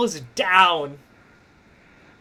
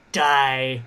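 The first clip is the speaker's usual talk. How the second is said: low, drawn-out threat; menacing wrestler; 6 semitones lower than usual. Acted. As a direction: intone it as a deadpan death threat. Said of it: "she just goes".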